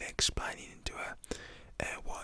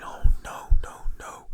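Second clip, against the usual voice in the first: distressed driver; 17 dB louder than usual. Retold or imitated. Crashed driver head in hands.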